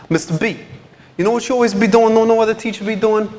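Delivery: put-on high, loud teen voice, slangy and curious; imitating a teenage student. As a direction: mimic the kid asking it exactly as he said it. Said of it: "he said it exactly like this".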